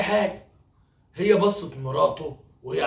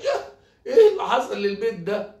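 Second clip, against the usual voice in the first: high, loud cry of dismay; raised, dramatic wail of disbelief; shocked outcry; 5 semitones higher, 5 dB louder than usual. Acted. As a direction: exclaim in acted shock at a disaster. Merchant shocked at ruined house.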